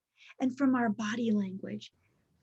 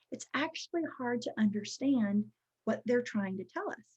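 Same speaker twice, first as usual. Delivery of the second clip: ordinary talk, nothing marked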